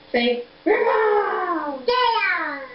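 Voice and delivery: high, very drawn-out call; playful calling. Pretend. Coaxing baby to say Grandpa, exaggerated call. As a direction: stretch the last syllable long and high.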